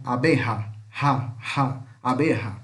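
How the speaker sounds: abeja with j as a throaty h; j in abeja like Brazilian initial R; jota as R sound. Shown as a demonstration. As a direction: say "abeha", isolating the ha. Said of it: "ele tem som de R".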